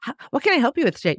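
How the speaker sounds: fast, high canned question; rushed clerk greeting. Retold or imitated. Clerk rushing a shopper, in a fast high chirp. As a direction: fire off the clerk line quickly. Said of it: "the first reaction, like".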